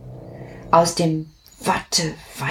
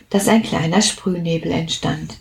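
ordinary delivery, nothing marked

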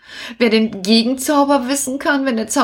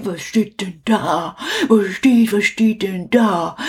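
ordinary talk

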